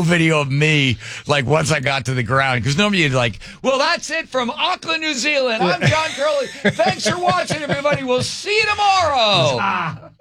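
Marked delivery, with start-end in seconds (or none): 3.6-10.1 mock TV sign-off. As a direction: ham up the anchor outro. Mimics his usual TV sign-off.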